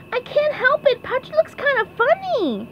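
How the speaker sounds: very high, squeaky cartoon voice; SpongeBob. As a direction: plead it in a pipsqueak voice. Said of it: "said SpongeBob".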